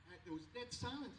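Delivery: normal talking voice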